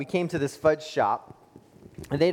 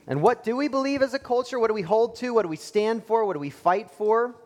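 much higher, urgent preaching questions; rhetorical challenge; 9 semitones higher than usual. Acted. Preacher challenging the congregation, much higher. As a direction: build the questions high and urgent.

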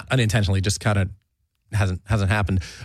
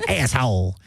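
copied Jersey impression; slower than usual. Host admires the co-host's impression, mimicked Jersey accent. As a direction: echo the mock Jersey "asshole". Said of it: "I wish I said it that cool".

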